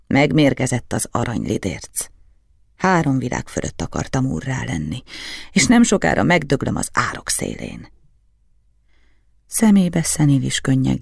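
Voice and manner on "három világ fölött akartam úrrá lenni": usual speaking voice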